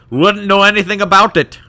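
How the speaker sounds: put-on high, loud, muddled Scottish-Indian accent; Scottish accent slipping into Indian. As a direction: deny it in a Scottish brogue drifting toward Indian. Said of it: "I'm even getting some Indian in there".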